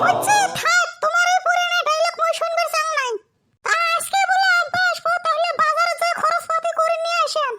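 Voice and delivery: high-pitched scolding wife voice; a wife nagging her husband to go shopping. Acted. Wife scolds husband about the in-law's visit, high and nagging.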